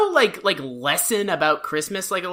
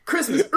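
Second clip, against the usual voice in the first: raised word, then a long, very loud throaty burst; Ben's cough; 5 semitones higher, 13 dB louder than usual. Acted. Imitating Ben's catchphrase, exaggerated coughing roar. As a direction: say it, then erupt in a hammed-up cough. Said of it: "like, coughs a little".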